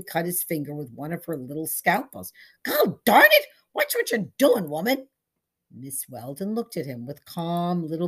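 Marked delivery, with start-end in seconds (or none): 2.6-5.1 sudden loud high yelp of pain; furious yell at the manicurist. Acted. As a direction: shout it as the blade nicks you. Cut finger, yells at manicurist.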